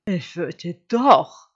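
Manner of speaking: lightly voiced wolf, slow and weighty; insisting wolf. Hungry wolf correcting the sheep, slow emphasis. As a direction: stretch it out with emphasis.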